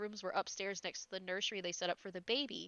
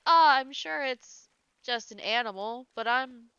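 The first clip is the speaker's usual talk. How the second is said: scared dad faking calm; 4 semitones higher, 8 dB louder than usual. Retold or imitated. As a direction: pretend to be brave while terrified. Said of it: "He's like".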